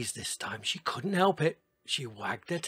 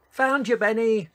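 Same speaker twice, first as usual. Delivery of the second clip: high, loud gleeful shout; 9 semitones higher, 9 dB louder than usual. Acted. Ellie finds Benny behind the anthill, shouting gleefully. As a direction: belt it out triumphantly. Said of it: "she shouted".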